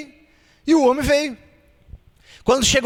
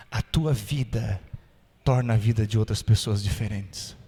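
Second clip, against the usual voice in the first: much lower, softer reflective preaching; hushed sermon; 11 semitones lower, 4 dB quieter than usual. Acted. Preacher drawing the lesson softly: hushed reflective sermon. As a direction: lean in and make them ponder.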